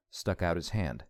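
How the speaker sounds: ordinary delivery, nothing marked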